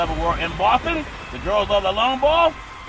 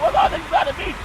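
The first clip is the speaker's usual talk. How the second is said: mock surprised squeal; 4 dB louder than usual. Buccaneers' quoted exclamation.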